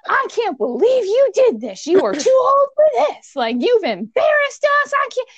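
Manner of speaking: high, loud, outraged scolding; furious mom rant. Retold or imitated. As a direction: tear into the child like an enraged mother. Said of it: "she just went Doctor Jekyll, Mister Hyde on me".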